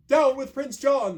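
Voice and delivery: rally the men round the king; shouted rallying cry. A narrator's reading. Outlaws shouting as they rally round the king, high and loud. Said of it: "they shout".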